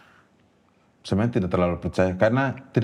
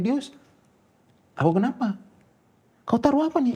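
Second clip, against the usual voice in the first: retold yell. Sister suddenly confronts sibling outside the toilet, high-pitched retold yell. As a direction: imitate someone crying out, pitched way up. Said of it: "tiba-tiba dia teriak".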